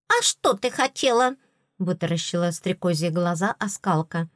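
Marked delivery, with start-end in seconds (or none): 0.1-1.4 surprised fairy. Acted. Tooth fairy asks, eyes bulging.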